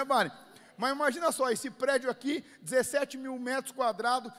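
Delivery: loud, driving exasperated list; full-force preaching. Real, not acted. Pastor telling congregation of building costs, loud and impassioned. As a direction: pile it up loudly for the congregation.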